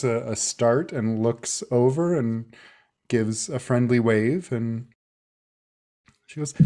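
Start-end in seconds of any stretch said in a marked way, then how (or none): none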